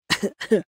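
showy, overdone cough; a theatrical cough for effect; dramatic cough. Acted. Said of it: "He coughed dramatically".

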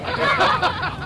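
a quick laugh that falls away; short burst of laughter. Real, not acted. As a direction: laugh once at your own joke. Laughs at his own joke. (अपने ही मज़ाक पर छोटी सी हँसी)

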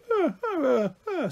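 very high little wordless squeals; toddler's eager noises. A narrator's reading. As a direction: squeak like a small child wanting chocolate. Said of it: "liked the chocolate and went".

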